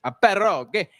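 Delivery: pierogi with a mock Italian accent. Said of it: "You say it in an Italian accent".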